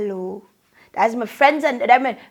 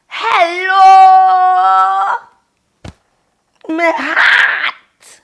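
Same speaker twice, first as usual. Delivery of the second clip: tearful greeting; 6 semitones higher, 8 dB louder, much slower than usual. Real, not acted. Emotional greeting to Saint Lucia.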